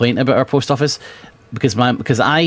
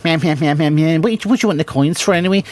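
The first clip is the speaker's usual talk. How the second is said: higher sing-song mockery of the clerk; blah-blah the clerk, then a quick snotty question; mocking meh-meh; 6 semitones higher, faster than usual. Son mimics the rude post office clerk, higher, fast and mocking.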